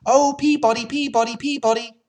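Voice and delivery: high whistled birdsong Peabody. A narrator's reading. Sparrow at the wood's edge calling out, high birdlike call.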